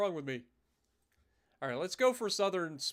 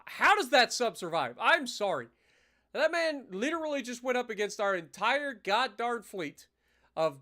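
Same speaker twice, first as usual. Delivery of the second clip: exasperated; 5 semitones higher, 4 dB louder than usual. Real, not acted. Indignant that sub survived.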